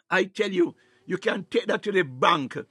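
normal talking voice

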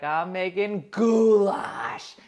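"goulash" stretched long and loud; slow, hammed-up announcement with a huge "goulash"; drawn-out goulash. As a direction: belt out "goulash", dragging both syllables. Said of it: "you have to say it like that".

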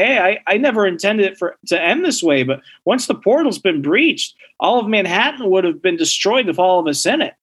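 normal talking voice

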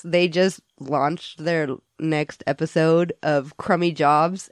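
normal talking voice

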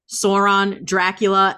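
ordinary talk, nothing marked

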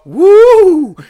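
very high, loud drawn-out woo; loud whoop. Retold or imitated. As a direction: let out a big showy whoop. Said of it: "I went like this, and I go".

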